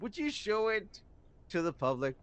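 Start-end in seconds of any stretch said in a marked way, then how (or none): none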